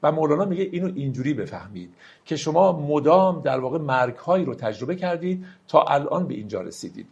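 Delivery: ordinary talk, nothing marked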